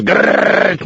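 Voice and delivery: high growling bus-scrape noise; imitated scraping noise. Telling friends how the bus scraped, imitated grinding noise. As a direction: mimic a bus scraping overhead.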